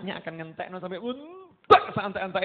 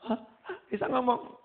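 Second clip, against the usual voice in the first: startled disbelief; 5 semitones higher than usual. Retold or imitated. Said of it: "Saya bapaknya kaget loh".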